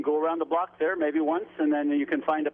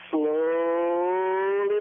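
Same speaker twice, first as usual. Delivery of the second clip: "slowly" stretched very long on the first syllable; drawn-out slowly. Real, not acted. Caller on slow construction progress, "slowly" drawn out long. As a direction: draw "slowly" way out, dragging the opening vowel.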